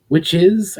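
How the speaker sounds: put-on nervous boy blurting out the question; blurted out. A narrator's reading. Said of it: "Amjo blurted out".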